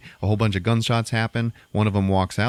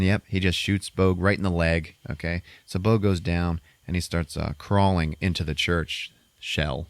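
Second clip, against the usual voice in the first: normal talking voice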